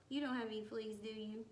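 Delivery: usual speaking voice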